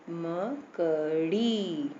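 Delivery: मकड़ी sounded slowly as ma, ka, then a long "ree"; long final vowel. Shown as a demonstration. Teacher showing the long ee takes time, slow syllables. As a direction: stretch the end of मकड़ी. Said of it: "time लगा ना".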